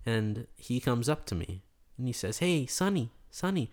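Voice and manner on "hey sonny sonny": high-pitched imitation of a man calling out; eager greeting. Returning customer hailing the burger guy, eager greeting. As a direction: hail him eagerly, voice lifted.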